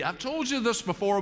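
ordinary delivery

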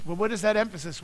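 usual speaking voice

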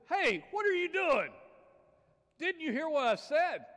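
very high, shrieking frog voice; loud squeaky protest; exasperated frog. Acted. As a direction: yell it as an outraged little frog.

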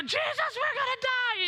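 hammed-up terror of drowning; panicked cry. Disciples panic to Jesus.

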